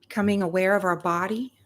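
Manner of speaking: unhurried meditation voice with long pauses between phrases; guided meditation. Real, not acted. Reverend leading listeners into their hearts and breath, guided meditation. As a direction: guide them gently into breath and gratitude, leaving space after each phrase.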